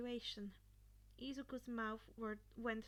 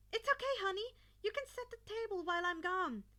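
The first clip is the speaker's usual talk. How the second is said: higher, louder, fast and gentle mother; tender mother; 5 semitones higher, 6 dB louder, faster than usual. A narrator's reading. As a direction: reassure him lightly on the way out. Mother answering son kindly.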